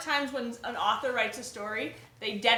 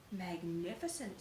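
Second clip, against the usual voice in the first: much softer, slow awed praise; hushed awe; 5 semitones lower, 12 dB quieter than usual. Acted. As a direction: breathe it out in awe.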